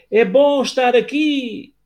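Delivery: high, slow and stretched like an echo; slow echo reply. Acted. The cave echoing the father's words, high and slow. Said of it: "a voz respondeu".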